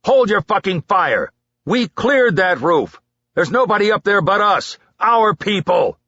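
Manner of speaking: loud, high, angry order; furious shouting. A narrator's reading. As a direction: tear into the soldier. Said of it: "the sergeant yelled".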